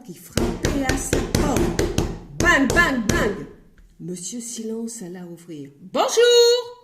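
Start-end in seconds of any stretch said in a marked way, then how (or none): 2.4-3.5 louder, higher banging knocks; loud knocking. A narrator's reading. Postman banging on the door. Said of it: "un bruit énorme". 5.8-6.8 much higher, loud yelled greeting; shouted hello. Acted. Postman yelling a greeting to Monsieur Silence. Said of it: "cria le facteur".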